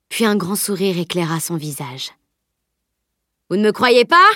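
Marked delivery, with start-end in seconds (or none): 3.5-4.3 higher, louder rising question; indignant cry. A narrator's reading. Boaster to his doubting companions, indignant cry. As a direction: cry out, stung by disbelief. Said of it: "cria-t-il".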